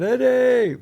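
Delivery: high mock shout of the name; calling out Eddie. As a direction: yell his name across the church. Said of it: "They were going".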